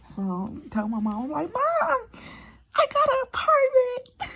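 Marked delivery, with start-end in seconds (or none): 1.5-4.3 much higher and louder, slow, crying with joy; tearful excitement. Real, not acted. Telling her mom about the apartment, crying with joy. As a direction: announce it through happy tears.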